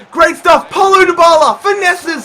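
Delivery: high, loud cheering of the move; excited shout. Real, not acted. Gamer cheering his striker's run, excited shout. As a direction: yell it like a fan. Big chance in the match.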